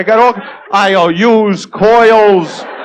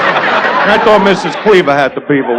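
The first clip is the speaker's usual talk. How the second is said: normal talking voice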